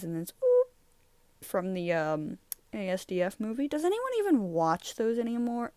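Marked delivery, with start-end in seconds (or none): 0.4-0.7 squeaky oop. Retold or imitated. Movie quote imitated.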